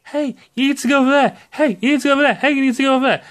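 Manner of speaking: lightly voiced pestering helper; lightly mock a nagging guide repeating itself; Navi. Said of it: "She could at least be Navi and say".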